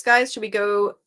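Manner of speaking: ordinary delivery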